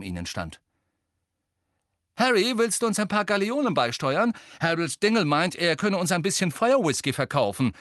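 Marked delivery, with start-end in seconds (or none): 2.2-7.7 loud, high call after someone. Acted. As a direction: call out across the crowd. Seamus calls after Harry.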